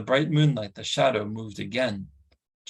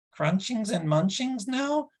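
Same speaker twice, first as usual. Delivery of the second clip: much higher begging creature voice; hopeful creature whine; 12 semitones higher than usual. Acted. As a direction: nudge for food once more. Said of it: "Gurgi suggested".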